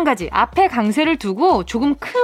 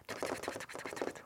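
rapid unvoiced whispered drumroll; 26 dB quieter, faster than usual. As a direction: build suspense with a very soft, fast drumroll. Suspense drumroll before quiz answer. (속삭이듯 빠르게 두구두구)

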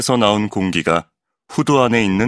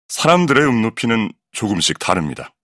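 ordinary delivery

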